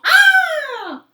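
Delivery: long, loud high "aah!"; drawn-out storybook shriek; fleeing scream. Acted. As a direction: scream in comic panic. Fox flees from dog.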